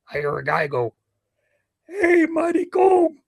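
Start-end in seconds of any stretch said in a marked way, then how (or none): 1.9-3.2 high, loud, drawn-out call; stretched-out street shout, much higher; calling across the street. Retold or imitated. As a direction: holler it slowly across a busy street.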